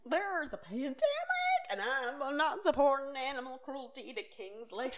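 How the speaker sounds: high, loud, drawn-out parody of outrage; mocking protest. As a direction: ham up the indignant excuse. Mocks Brittany's protest, echoing friend.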